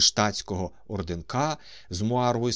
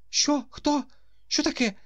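high, loud startled cries; startled old man shouting; 3 dB louder than usual. A narrator's reading. Old man seized by Ostap cries out in alarm. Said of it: "закричав неспокійний старий".

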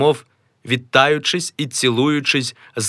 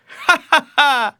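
high, slow jeering laugh; a put-on theatrical ha-ha-ha; mocking laugh; 4 dB louder, slower than usual. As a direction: laugh at him out loud.

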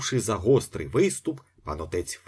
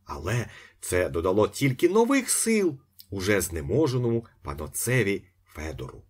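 plain reading voice

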